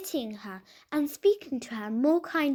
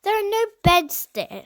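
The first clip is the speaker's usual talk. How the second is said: louder, higher curt refusal; disagreeable tone; 7 semitones higher, 7 dB louder than usual. A narrator's reading. Aunt refusing the children a place to sleep, disagreeable. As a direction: brush them off coldly. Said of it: "in a disagreeable tone".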